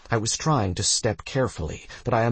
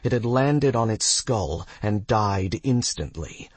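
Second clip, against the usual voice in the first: usual speaking voice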